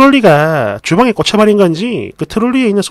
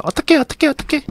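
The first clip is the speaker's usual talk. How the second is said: imitating a panicked mom, much higher but soft; hammed-up, breathy-free panicky whimper, higher pitched; mock panic; 7 semitones higher, 5 dB quieter than usual. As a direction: squeal "어떡해" like a flustered mother. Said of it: "깨악깨악 소리만 지르고 발망동동 구르면서".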